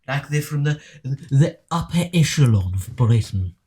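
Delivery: put-on upper-class film-actor British accent; posh British accent. Mimicking Harry Potter actors for friends, posh British accent. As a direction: put on upper-echelon English. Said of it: "talks like this".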